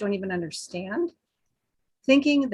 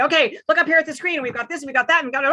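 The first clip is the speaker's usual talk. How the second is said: fast, loud and much higher, rapid-fire; rushed presenter; 6 semitones higher, 6 dB louder, much faster than usual. Shown as a demonstration. Speaker mimicking a hyper presenter for the panel, fast loud rush. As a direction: race through it like an overeager presenter at the screen. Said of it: "people who talk like this".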